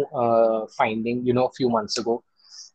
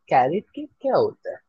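puzzled customer; 3 semitones higher, much faster than usual. Retold or imitated. Stores retell puzzled customers.

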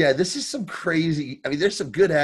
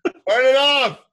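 angry mechanic; 5 semitones higher, 5 dB louder than usual. Pretend.

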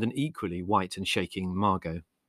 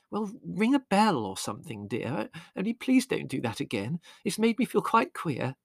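higher, pleading mother; mother asking son not to do it again; shaken mother; 7 semitones higher than usual. A narrator's reading. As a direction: plead gently but shaken. Mother pleading after the shock.

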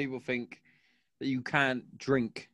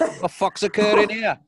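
higher, louder incredulous outburst as the cop; mock baffled cop; 7 semitones higher, 5 dB louder than usual. Imitating the cop baffled by a sober driver, higher and louder. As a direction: play the cop as totally thrown. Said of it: "He was like".

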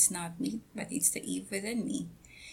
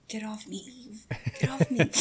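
mock scolding; 4 semitones higher, 4 dB louder than usual. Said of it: "I'm like".

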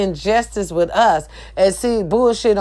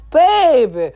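loud exclaim; 8 semitones higher, 4 dB louder, much slower than usual. Real, not acted. Exclaiming, cry.

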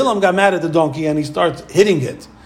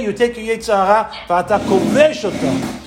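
normal talking voice

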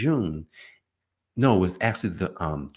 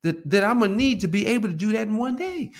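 complaining body; 12 semitones higher than usual. Retold or imitated. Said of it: "The body's gonna say".

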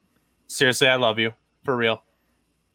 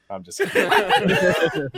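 slow, higher, laughing it off; 9 semitones higher, much slower than usual. Real, not acted. Host taking back a joke, laughing. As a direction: let the laugh carry it.

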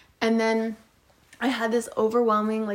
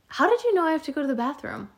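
higher, fast puzzled question; puzzled friend; 6 semitones higher, much faster than usual. Retold or imitated. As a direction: quote the friend, baffled and quick.

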